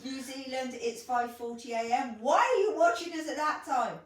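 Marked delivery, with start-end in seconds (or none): 2.2-4.0 higher, louder astonished question; incredulous scolding. Real, not acted. Co-host to viewer watching at dawn, incredulous and louder. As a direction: demand an explanation in disbelief.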